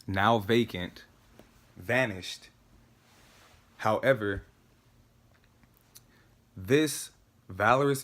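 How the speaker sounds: measured monologue with long pauses; careful, spaced-out declaiming; deliberate recitation. Acted. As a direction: recite it phrase by phrase, weighing each word.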